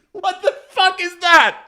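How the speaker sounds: high-pitched, incredulous, breaking into laughter; laughing disbelief. Real, not acted. Streamer reacts to the awful accent, laughing in disbelief. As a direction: squeal it slowly through real laughter.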